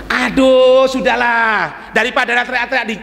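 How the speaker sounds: normal talking voice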